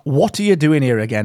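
normal talking voice

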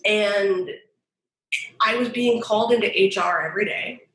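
ordinary talk

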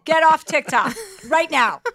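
put-on bossy older sibling ordering her off the phone; mock scolding. Teasing her sister, a slow loud mock scold.

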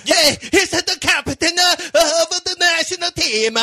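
bad Italian accent. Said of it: "the stereotypical Italian bad accent that we all do".

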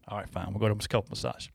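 low, very soft mock surrender; resigned giving-in. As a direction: act out caving in to the wife under your breath. Retold giving in to wife.

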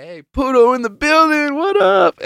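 high, loud hype call; excited friend's shout-out. Retold or imitated. Zach spots the dancing gif, high excited shout-out. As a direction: imitate a friend hollering a big greeting. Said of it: "Zach saw that shit. He's like".

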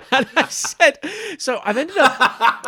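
high, loud laughter through the words; real laugh at his own bad deal. Real, not acted. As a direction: crack up while telling it.

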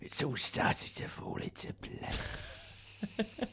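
breathy slow whisper. Pretend. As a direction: hush it out slowly. Whispered joke.

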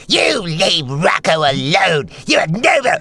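drawn-out, hammed-up quarrelling character; imitated studio outburst. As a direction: stretch it out, mock-indignant. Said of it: "he's just like, papers are flying".

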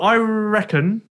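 high, slow "I reckon"; drawn-out deliberation. Real, not acted. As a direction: draw out "I reckon" while weighing a guess. Stalling before giving a guess.

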